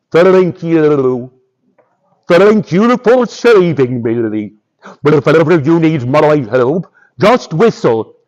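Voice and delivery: bubbly, warbling underwater fish voice; burbling grateful fish. Acted. Rescued fish promises Boots its help, burbling gratefully. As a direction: wobble every word like a talking fish.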